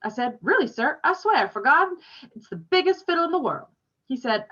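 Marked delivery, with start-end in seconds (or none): 0.4-3.7 higher and louder, rushing to convince; a boy eagerly insisting about his dad's fiddle. Acted. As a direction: swear it's true, eager and keen.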